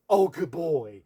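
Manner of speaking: loud, delighted praise; happy praise. Real, not acted. Trainer praising Bear for sitting, loud and delighted. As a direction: celebrate the dog's sit out loud.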